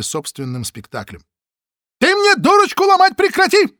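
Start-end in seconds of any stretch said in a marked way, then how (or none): 2.0-3.8 very high, loud screeched order; shrill shriek. Acted. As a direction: squeal with rage. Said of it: "взвизгнул Г П Ушник". (визгливый крик)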